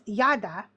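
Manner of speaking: Yada said as YAH-dah; Hebrew pronunciation. Shown as a demonstration.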